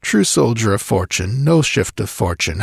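ordinary delivery

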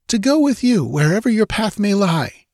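high, ardent heroine voice; woman's cry. A narrator's reading. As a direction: cry out in devotion. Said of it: "she cried".

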